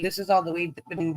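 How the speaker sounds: normal talking voice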